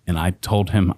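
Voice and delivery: ordinary delivery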